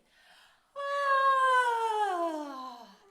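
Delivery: loud, high, falling stretched yawn sound; big yawn. Shown as a demonstration. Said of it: "Yawn with me".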